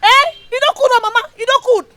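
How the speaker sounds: high-pitched, heated rant; angry scolding. Acted. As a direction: have it out with your mother at a yell. Daughter quarrels angrily with mother.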